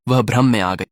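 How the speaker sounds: ordinary delivery, nothing marked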